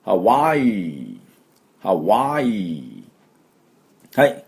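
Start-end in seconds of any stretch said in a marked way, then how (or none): 0.1-3.0 very slow ハワイ with a throaty ハ; model pronunciation. Shown as a demonstration. Teacher leading the class to repeat ハワイ slowly. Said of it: "私の後について発音しましょう".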